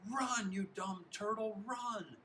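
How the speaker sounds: high, drawn-out exasperated yell; scream. A narrator's reading. Hobbin runs alongside Zinkoff, screaming in his ear. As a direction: scream at a hopelessly slow runner. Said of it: "and screams in his ear".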